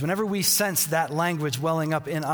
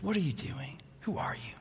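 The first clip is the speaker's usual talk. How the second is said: hushed critic voice; 8 dB quieter than usual. Acted. The inner critic taunts the listener in a hushed whisper. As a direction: drop the voice right down to a whisper.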